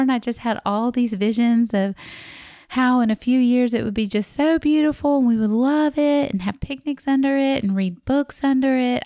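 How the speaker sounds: building wistful delight; dreamy excitement. Retold or imitated. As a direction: gush about the picnic visions. Dreamy visions of the oak tree.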